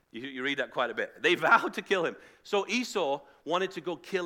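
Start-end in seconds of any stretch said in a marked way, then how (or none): 1.2-2.1 loud quoting with a laugh; mock-dramatic proclamation. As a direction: boom out the stock phrase, amused. Repeats the biblical phrase, laughing.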